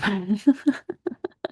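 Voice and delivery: light, breathy chuckle to oneself; amused run of chuckles; real laugh. Real, not acted. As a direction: laugh at your own remark. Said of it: "I'm laughing about this".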